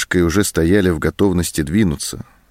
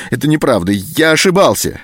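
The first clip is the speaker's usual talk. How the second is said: desperate denial; 7 semitones higher, 4 dB louder than usual. A narrator's reading. Anton denies under threat.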